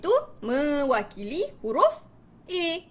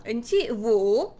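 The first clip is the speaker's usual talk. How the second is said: slow, lower storytelling with a rising end; storytelling demo; 3 semitones lower, much slower than usual. Shown as a demonstration. Teacher showing parents how to tell children a story, storytelling. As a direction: draw it out for young listeners. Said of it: "contoh kan dekat si".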